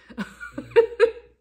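loud, high laugh that breaks out; burst of laughter. Real, not acted. Laughing at herself over candy, loud high laughter. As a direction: laugh at your own silliness. Laughs at her Reese's Pieces craze.

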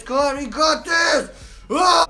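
loud, high, strained cheer; cheering shout. Real, not acted. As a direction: yell to push through. Cheering through final seconds.

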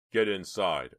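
loud, urgent command; firm order. A narrator's reading. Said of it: "ordered Chuck".

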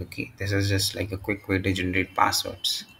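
ordinary talk

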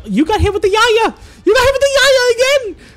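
very high, loud outcry, rising further; outraged disbelief, building. Real, not acted. Commentator reacting to the same move landing twice, building outraged squeal.

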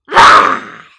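very loud, harsh roar; monster roar. Acted. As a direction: let out a terrible monster roar. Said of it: "they roared their terrible roars".

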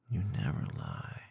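ordinary delivery

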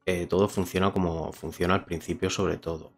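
voz de presentador, pausada; reseña a cámara. Acted. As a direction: cierra el vídeo con la opinión. Closing review to camera. (tono de reseña)

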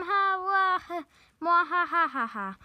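hammed-up evil cackle; villain laugh. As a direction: attempt a bad villain laugh. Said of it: "work on my villain laugh".

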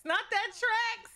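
high, slow, drawn-out "tracks"; disbelieving high drawn "tracks"; mock exclaim. Real, not acted. Repeats phrase differently.

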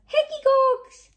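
high, loud shout of delight; excited cry. A narrator's reading. George spotting hedgehogs in the grass, excited shout. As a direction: call out, pointing. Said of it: "he cried".

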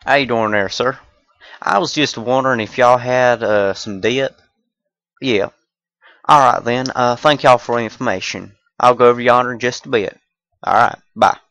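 put-on a Southern accent asking a shop for dip. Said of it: "random accent skits".

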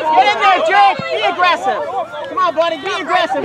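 yelling from the sidelines. Real, not acted. Cheering son from sidelines.

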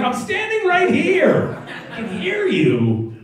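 telling off the laughing subway workers, high and slow; indignant protest. Retold or imitated. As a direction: plead in disbelief, drawn out. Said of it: "I was like".

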